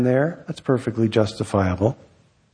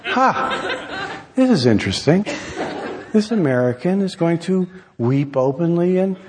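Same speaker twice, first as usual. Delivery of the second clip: high, intrigued onlooker; amused, mildly surprised curiosity, pitched up; sudden curiosity; 5 semitones higher than usual. Acted. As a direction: act out an audience member perking up with interest. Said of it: "look up with sudden curiosity".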